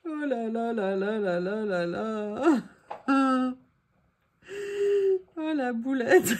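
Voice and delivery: slow, laughing oh là là; laughing dismay. Real, not acted. Crafter laughing at her blunder to save face, slow. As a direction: drag it out through laughter.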